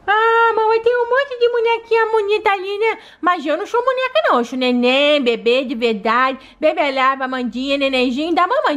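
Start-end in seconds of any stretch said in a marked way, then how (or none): 0.1-6.4 put-on high little toddler voice; a small child talking to her mom; baby doll voice. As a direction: play the sick baby begging to play.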